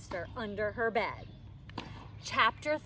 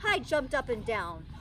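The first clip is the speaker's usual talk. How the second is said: ordinary delivery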